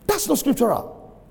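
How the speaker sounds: high-pitched, insistent verdict; emphatic repeat. Real, not acted. Preacher repeats his verdict on staying put seventeen years, high emphatic protest.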